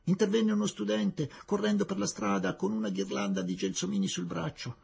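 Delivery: ordinary delivery, nothing marked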